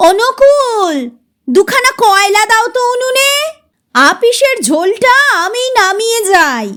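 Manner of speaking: high calling voice; calling the servant. Acted. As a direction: call across the kitchen. Mother calls servant. (মা চাকরকে ডাকছে)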